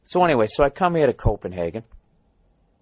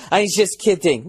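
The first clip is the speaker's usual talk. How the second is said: mock smirking Danish cabbie; 6 semitones higher than usual. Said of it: "he does this little pussy smile".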